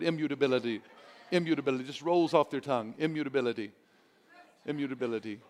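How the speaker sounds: immutability with every syllable crisp; over enunciating. Shown as a demonstration. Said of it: "forces me to enunciate every syllable".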